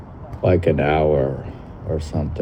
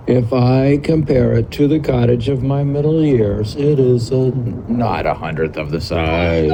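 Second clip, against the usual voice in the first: raised, declaimed literary reading; reading old text aloud; 7 semitones higher, 4 dB louder than usual. Acted. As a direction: read it out high, like a recitation.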